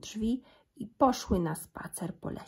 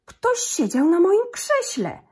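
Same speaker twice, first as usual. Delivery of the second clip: slow, much higher, louder angry papa bear; gruby zrzędliwy głos; 11 semitones higher, 8 dB louder than usual. A narrator's reading. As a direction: bellow it slowly like an outraged big bear. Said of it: "grubym, zrzędliwym głosem". (tata niedźwiedź, zdenerwowany, woła wolno i głośno)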